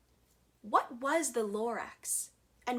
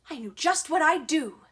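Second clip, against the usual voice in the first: higher and much louder burst; excited; 5 semitones higher, 9 dB louder, faster than usual. Acted. Onceler seizing his plan, excited burst. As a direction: blurt out the big idea. Sudden joyful idea.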